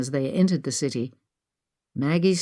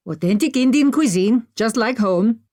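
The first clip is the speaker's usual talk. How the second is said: put-on higher, proud Indian-accented voice; raised, lively Indian accent; 7 semitones higher, 4 dB louder than usual. A narrator's reading. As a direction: boast of the food in an Indian accent. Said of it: "with an Indian accent".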